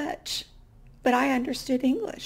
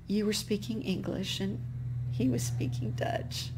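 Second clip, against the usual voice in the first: a touch of dazed, softer echo; mild stunned murmur, half to herself; 3 semitones lower, 7 dB quieter than usual. Retold or imitated. Pope on the train home, mildly dazed and quiet. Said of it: "kind of dazed, a little bit in shock".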